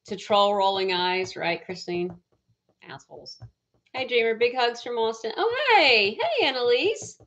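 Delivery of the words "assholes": lower, much softer, under her breath; muttered insult. Real, not acted. Cursing trolls in the chat under her breath. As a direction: drop it under your breath like a private curse.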